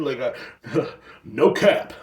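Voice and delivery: usual speaking voice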